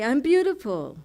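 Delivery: high, loud proud claim; defiant. Retold or imitated. Answering the critic, proudly defiant. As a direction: stand up for yourself. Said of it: "And I say".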